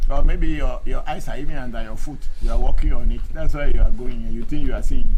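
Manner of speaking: loud, fast rebuke; angry lecture to the commenter; heated scolding. Real, not acted. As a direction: scold him for not seeing. Continued angry rebuttal.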